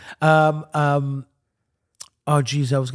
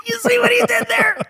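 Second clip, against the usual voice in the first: laughing. Real, not acted. Laughing at a pun.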